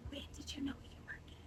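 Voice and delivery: breathy secret whisper. Retold or imitated. Coworker whispering a nosy question, hushed whisper. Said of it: "he whispers to me".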